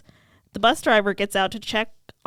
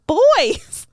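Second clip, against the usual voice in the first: high, loud exclamation; girls in unison; 11 semitones higher, 8 dB louder than usual. Retold or imitated. Two girls answering Giles in unison, high and loud. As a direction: blurt it out like two excited girls. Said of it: "the two girls in unison say".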